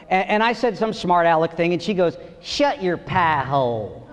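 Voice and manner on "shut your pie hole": slow, low Southern drawl; Kentucky accent. Retold or imitated. Wife teasing the comic after the show, slow Kentucky drawl. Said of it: "it's the accent that gets you".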